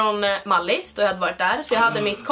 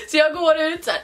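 normal talking voice

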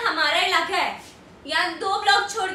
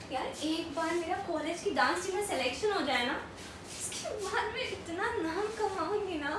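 soft, musing to a friend; wistful daydream; 2 semitones lower, 9 dB quieter than usual. Acted. College girl dreaming of dance team fame, soft wistful voice. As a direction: confide the dream softly.

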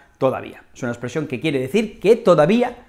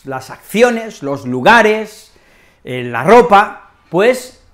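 ordinary delivery

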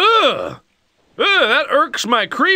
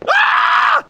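mock coffee meltdown; slower than usual. Tired employee raging without coffee, high hammed-up shrieks. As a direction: scream like you're losing your mind over coffee. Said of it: "I just get a little aggravated".

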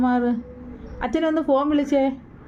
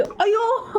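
high, drawn-out playful "ayyo"; hammed-up cry of pain; mock wail; 7 semitones higher, much slower than usual. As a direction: pretend you've been hurt, in a high wail. Mother play-acts being hit by child.

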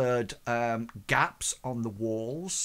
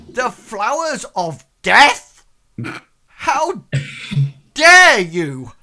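high, loud, slow outrage building to a shout; scandalised noblewoman rounding on her suitor; outraged lady; 8 semitones higher, 11 dB louder than usual. Acted. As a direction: she explodes at the gift, higher and louder. Noblewoman enraged by death flowers.

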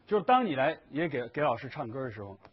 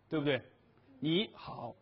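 usual speaking voice

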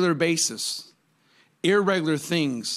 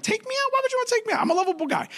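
much higher, incredulous squeak of surprise; mock disbelief; much faster than usual. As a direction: play shocked innocence, way up high. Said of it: "I'm like".

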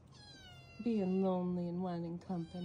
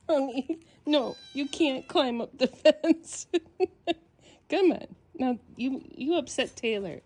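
loud laughing scolding; 6 semitones higher, 12 dB louder than usual. Real, not acted. Laughing loudly at the kitten while scolding it.

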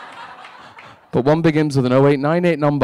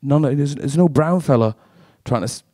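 usual speaking voice

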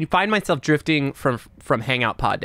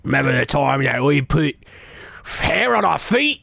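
Dominic Monaghan impression. Mimicking Dominic Monaghan for the co-host, mock accent. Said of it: "Yep, that sounds like him".

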